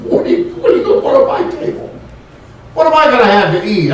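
high, fast, half-laughed complaint; laughing mock plea. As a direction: laugh through a pretend hungry demand. Mock complaint of hungry person.

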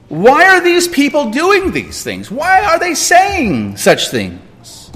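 high-pitched, pleading protest; acted questioning of God. Acting out doubting complaint to God.

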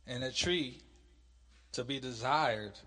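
loud, measured Bible reading; scripture reading. Acted. Teacher reads God's command from Genesis to class, scripture reading. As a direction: read it out slowly, with weighty stresses.

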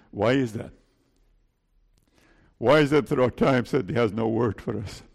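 ordinary talk

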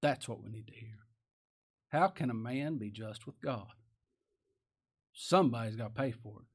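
ordinary delivery

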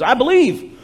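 high, loud, acted profession of faith; eager declaration. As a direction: re-enact a convert bursting out. Acts the eunuch's confession of faith.

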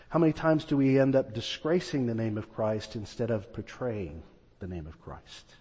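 ordinary talk

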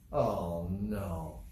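low, sinking dismay; mock gloomy disbelief; dismayed soft pastor. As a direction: let it sag down, low and hushed. Acts dismayed Moses.

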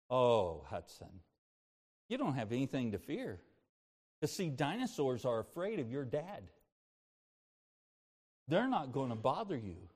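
low, soft, tender comfort; quiet fatherly reassurance, retold; reassuring dad. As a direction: soothe a scared child at bedtime. Father reassures scared son, retold.

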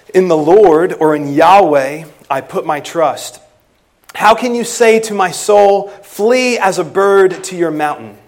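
loud, ringing scripture read from the pulpit; preacher reading the psalm aloud. Acted. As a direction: proclaim the verse to the congregation. Reads the psalm text aloud.

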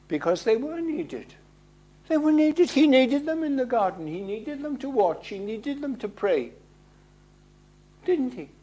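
high, pressing, needed hammered; urgent climax. Real, not acted. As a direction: lift it to a plea.

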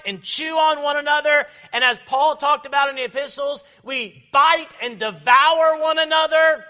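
loud, high, fervent sermon delivery; full-force preaching. Acted. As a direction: preach it out loud to the whole congregation.

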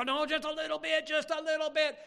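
high, singled-out coaxing plea; mock-innocent, high-pitched insisting; wheedling insistence. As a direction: press the point over her protest. Acting out insisting to the mom.

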